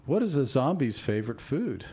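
usual speaking voice

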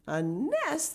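high-pitched incredulous question; taken aback, voice leaping up; astonished. Acted. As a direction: echo it in surprise. Family astonished at the plan.

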